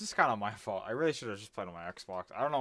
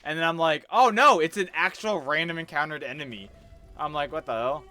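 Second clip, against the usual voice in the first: higher and louder, worked up; agitated retelling; 7 semitones higher, 13 dB louder than usual. Real, not acted. Gamer still worked up, retells his death to friend. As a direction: rant it, still rattled.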